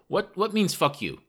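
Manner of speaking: high voice, broken English; loud foreign questioner. A narrator's reading. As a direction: ask eagerly as a proud English learner. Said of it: "he spoke rather loudly".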